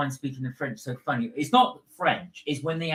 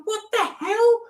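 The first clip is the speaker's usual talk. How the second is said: much higher, louder shocked cry; shocked disbelief; 5 dB louder than usual. Retold or imitated. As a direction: squeal in outrage at the bill. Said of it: "I thought".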